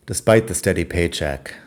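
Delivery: ordinary talk, nothing marked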